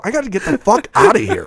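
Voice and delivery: high, strained mock panic; exasperated squeal. Telling a friend about fleeing the rave, squeaky exasperation. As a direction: squeak it out like you're desperate to escape. Retold exasperation leaving party.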